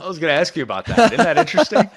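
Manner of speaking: quick, high, loud jumping in; amused, excited. Real, not acted. Host eagerly agreeing about the missing accent, amused and excited. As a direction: burst in delighted, pitch leaping up.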